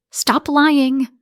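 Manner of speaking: high, loud outburst; angry snap. A narrator's reading. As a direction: snap it out, pitched well up. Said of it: "I snapped, voice shaking".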